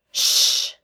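sharp voiceless shh; hissed shush. A narrator's reading. Sam shushing Josh in the governor's office, sharp hiss. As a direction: hush him urgently. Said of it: "Sam hissed".

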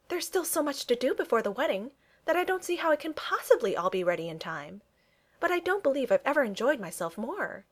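enthusiastic. A narrator's reading. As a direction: bubble with mild enthusiasm. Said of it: "her eyes sparkling with enthusiasm".

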